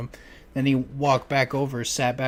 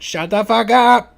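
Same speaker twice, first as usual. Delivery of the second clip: mock angry yell; 9 semitones higher, 9 dB louder, slower than usual. Retold son yelling at mom.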